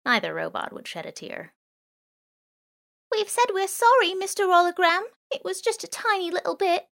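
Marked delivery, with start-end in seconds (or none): 3.1-6.9 much higher, small pleading voice; mewing plea. Acted. Girl to the drone, mewing a cute apology. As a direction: mew it like a sorry kitten. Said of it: "mewed the girl".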